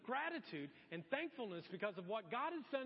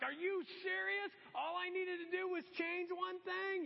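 higher, louder incredulous complaint; pitched-up mock outrage, slowing on the complaint; fed-up exasperation; 9 semitones higher, 4 dB louder than usual. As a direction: vent at the customer service rep. Fed up calling Comcast.

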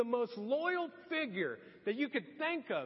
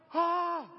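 scared gasp; 6 semitones higher, 6 dB louder than usual. Retold or imitated. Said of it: "they're like".

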